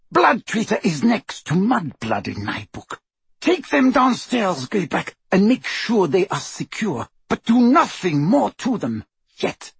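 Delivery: high, imperious commanding; menacing orders. A narrator's reading. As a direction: issue orders with relish, pitch up. Bellatrix threatens and commands.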